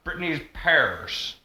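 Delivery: slow Southern drawl "Britney's pears"; exaggerated Southern accent. Shown as a demonstration. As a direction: stretch it like a Southern girl. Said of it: "I exaggerated it for effect".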